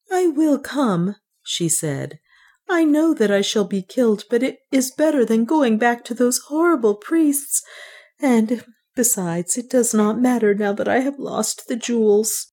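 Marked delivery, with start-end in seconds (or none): 0.1-1.2 high, slow, reluctant consent; resigned frightened heroine. Acted. Juana agrees to cross, high and slow resignation. 2.7-12.5 high, mournful heroine voice; despairing heroine. Acted. Juana would rather die than return, high despairing voice.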